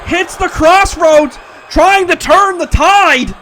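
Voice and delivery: high, loud, drawn-out commentator calls; excited play-by-play. Acted. Commentator calling a near fall to viewers, loud and excited. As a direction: call the big moves like a hyped announcer.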